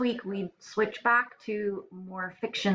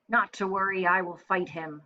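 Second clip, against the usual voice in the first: ordinary delivery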